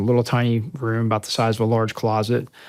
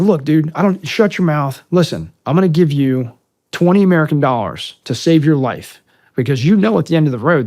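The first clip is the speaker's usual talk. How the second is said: louder, forceful insistence; retold ultimatum; 5 semitones higher, 6 dB louder than usual. Marine offering a seller money to save his life, retold forceful insistence. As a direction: lay down the offer firmly, as retold.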